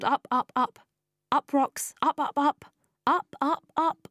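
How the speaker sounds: breathless-sounding urgency, pitched high; frantic climbing. A narrator's reading. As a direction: scramble upward word by word. Climbing, panicked scramble uphill.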